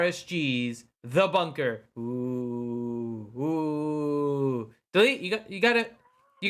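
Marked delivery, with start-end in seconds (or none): 2.0-4.7 low spooky ghost moan; ghost noise. Pretend.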